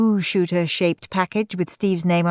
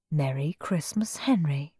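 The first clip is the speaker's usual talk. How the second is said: whisper; 2 semitones lower, 6 dB quieter than usual. Acted. Steve reads label.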